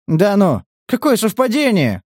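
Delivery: high, mock-surprised sarcasm; smiling mockery. A narrator's reading. Captor ridiculing the prisoner's claim, smiling mockery. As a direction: feign delighted surprise with a smile. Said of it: "улыбнулся Стас".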